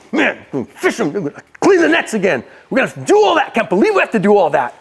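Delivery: exasperated griping behind his back; mock put-upon moaning; grumbling aside. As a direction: complain to yourself, voice jumping up in protest. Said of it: "as he turns away where Jesus ain't looking".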